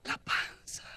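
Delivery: stunned whisper; hushed shock. Acted. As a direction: whisper the startling discovery. Oseas nota el embarazo al abrazarla. (susurro de asombro)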